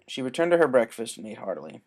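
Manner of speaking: usual speaking voice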